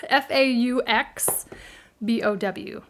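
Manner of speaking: F A U X B O W spelled out slowly, each letter separate. Shown as a demonstration. Crafter spells the name for her viewers, slowly letter by letter. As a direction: spell out F A U X, then B O W, one letter at a time. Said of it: "I'm calling it a faux bow".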